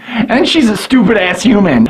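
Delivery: ordinary delivery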